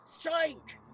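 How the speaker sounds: rising urgent command to strike; urgent cry. Acted. Van Helsing urging Harker to strike the stake, urgent cry. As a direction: urge him on, pitch climbing.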